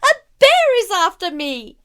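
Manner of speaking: high, loud panicked cry; child shrieking about a bear; terrified shriek. A narrator's reading. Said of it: "I shrieked".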